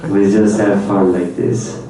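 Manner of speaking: normal talking voice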